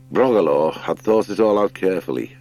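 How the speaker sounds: usual speaking voice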